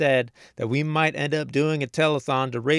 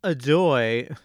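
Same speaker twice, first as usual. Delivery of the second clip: ordinary delivery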